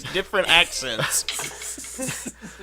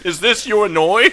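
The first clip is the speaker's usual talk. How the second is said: mock Cockney; 6 semitones higher, 6 dB louder than usual. Player parodies the guard's Cockney.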